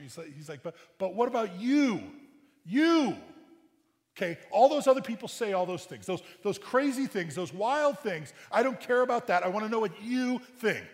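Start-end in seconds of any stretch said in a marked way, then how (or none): 0.6-3.2 much higher and louder, jabbing YOU; emphatic demand. Acted. Jesus pressing his disciples, loud and emphatic. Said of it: "the structure is an emphatic structure". 4.2-10.9 much higher and louder, jabbing YOU; emphatic demand. Acted. Jesus pressing his disciples, loud and emphatic. Preacher voicing Jesus' challenge.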